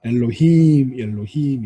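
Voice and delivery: "엘로힘" with a long, stressed last syllable; final-syllable stress. Shown as a demonstration. Teacher demonstrating Hebrew word endings, "엘로힘" stressed on the last syllable. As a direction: land hard on the end of "엘로힘". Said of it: "강세가 맨 마지막에 옵니다".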